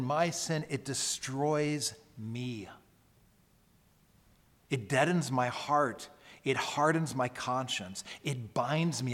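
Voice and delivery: normal talking voice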